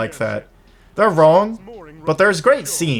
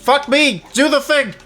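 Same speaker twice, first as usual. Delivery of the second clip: high panicked shout; frightened yelling; 13 semitones higher, 4 dB louder than usual. Real, not acted. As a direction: yell in real panic at the game. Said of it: "This is terrifying".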